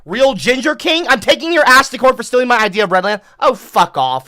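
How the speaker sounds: high, loud outraged rant; indignant rant. Real, not acted. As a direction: rant at them, scandalised. Ranting at chat names.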